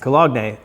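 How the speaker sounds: "Cologne" said co-log-nay; joke pronunciation. Pretend. Co-host introducing another estate sale find, jokily mispronouncing. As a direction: say "Cologne" in three syllables, ending in nay. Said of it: "say it the right way".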